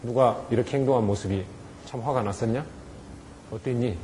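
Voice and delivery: soft, calm question; gentle asking. Shown as a demonstration. Teacher softly asks the child how he felt. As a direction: invite the child to talk. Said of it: "서로 얘기를 해보는 거예요".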